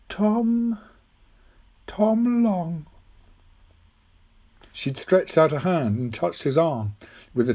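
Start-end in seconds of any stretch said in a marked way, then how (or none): 0.1-2.9 put-on high old lady's voice; old woman, wondering. Old woman repeating the boy's name, high and wondering.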